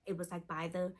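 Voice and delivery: ordinary delivery, nothing marked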